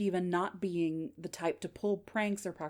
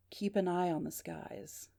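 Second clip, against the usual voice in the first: slow, soft mysterious caller; faint, measured phone voice; weak, unclear voice; 6 dB quieter than usual. Acted. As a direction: let each word out slowly and faintly. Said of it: "This part is weak and unclear".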